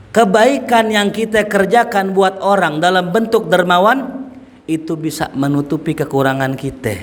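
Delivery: normal talking voice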